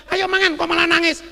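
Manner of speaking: loud, high acted question; baffled friend. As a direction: imitate a pal puzzled by tears. Friend baffled by his crying.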